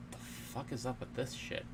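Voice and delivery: ordinary talk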